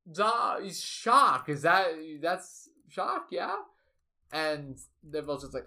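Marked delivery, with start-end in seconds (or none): 0.1-3.5 put-on higher, slow German-accented recognition. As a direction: spot someone slowly in a German accent. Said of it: "they're gonna have a German accent".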